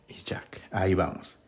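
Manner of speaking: soft unvoiced aside; whisper. Real, not acted. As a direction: whisper it to yourself.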